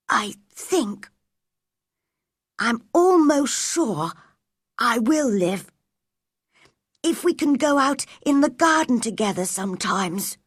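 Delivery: halting start, then a hopeful high voice; calmer sick boy. A narrator's reading. Colin giving Mary his hand, calmer and hopeful.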